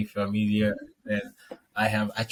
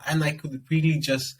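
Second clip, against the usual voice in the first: ordinary delivery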